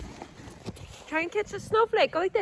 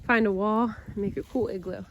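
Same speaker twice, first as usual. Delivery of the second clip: ordinary talk, nothing marked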